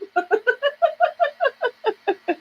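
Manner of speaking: long high-pitched giggling laugh; real laughter. Real, not acted. Streamer amused by a viewer rough on men, long laugh.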